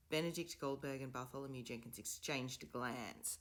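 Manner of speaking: usual speaking voice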